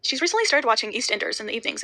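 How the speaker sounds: ordinary delivery, nothing marked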